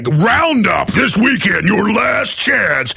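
slow, booming, high announcer shout; monster truck ad. Retold or imitated. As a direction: stretch it out like a monster truck promo. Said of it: "Sounds like it's a monster truck advertiser".

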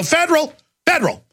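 high sing-song flashing a badge; mock robbers. Bank robbers fooling cops, high mock chant. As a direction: chirp it like a magic password. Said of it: "They go".

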